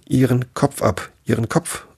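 half-hearted roar of an enraged queen; mild mock bellow. A narrator's reading. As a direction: pitch up the command, keep it contained. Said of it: "fing sie an zu brüllen".

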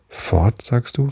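put-on mildly startled child, rising into a question; lightly voiced surprised little child; child's exclamation. A narrator's reading. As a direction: echo it in small-voiced disbelief. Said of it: "rief die Kleine".

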